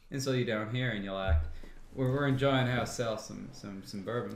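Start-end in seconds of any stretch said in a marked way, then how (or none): 1.9-4.4 Southern drawl. Retold or imitated. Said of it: "If you slip way down south".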